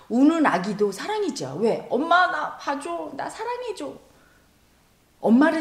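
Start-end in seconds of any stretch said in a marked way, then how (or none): 1.9-3.9 put-on high, slow little-child plea; a child's voice, higher toward a faint end; pleading baby voice. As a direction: beg for mommy's attention like a small child. Preacher voices crying baby's plea.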